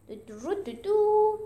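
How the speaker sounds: high, loud, drawn-out trumpet fanfare; sung-out "doo-doo" horn call; imitated trumpets. A narrator's reading. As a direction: toot like a trumpet, slow and loud.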